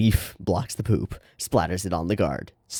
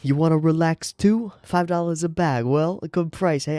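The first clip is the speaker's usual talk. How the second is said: higher-pitched New York dealer voice; New York accent; 8 semitones higher than usual. Acted. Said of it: "like, New York".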